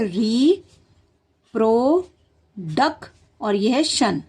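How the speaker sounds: री, प्रो, डक, शन said as separate chunks; syllable by syllable. Shown as a demonstration. Teacher splits reproduction into syllables for kids. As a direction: break the word into pieces. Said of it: "तो हम कैसे याद करेंगे".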